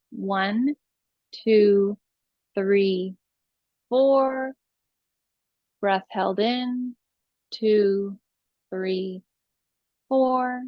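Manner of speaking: slow, evenly spaced counts; drawn-out numbers with long gaps between them; meditative breath counting. Real, not acted. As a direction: count calmly to pace someone's breathing.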